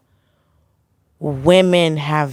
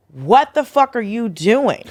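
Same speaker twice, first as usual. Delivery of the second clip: slow, higher-pitched incredulous challenge; retold outrage; 4 semitones higher, slower than usual. Telling how she confronted a comic friend, incredulous. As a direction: stretch it out in mock disbelief.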